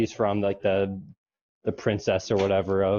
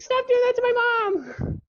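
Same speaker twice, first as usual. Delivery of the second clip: mock shriek in high falsetto; Mina. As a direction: imitate a girl protesting shrilly. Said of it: "then Mina's like".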